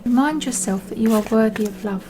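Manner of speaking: normal talking voice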